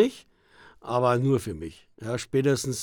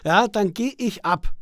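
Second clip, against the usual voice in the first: usual speaking voice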